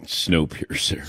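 breathy low whisper; whispered title. Acted. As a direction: hush it like a secret. Running gag whispered title.

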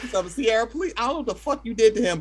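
ordinary talk

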